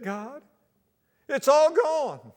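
mock whine to God; whining complaint. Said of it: "began to whine and complain to God".

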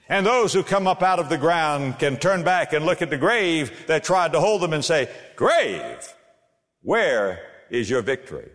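soaring pulpit cadence; preaching at full force. Acted. Preacher exhorting his congregation, in full preaching voice. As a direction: rise up and proclaim it.

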